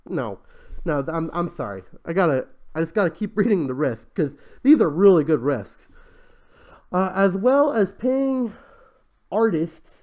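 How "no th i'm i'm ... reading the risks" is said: ordinary delivery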